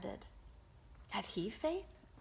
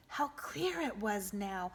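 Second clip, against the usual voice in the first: ordinary delivery, nothing marked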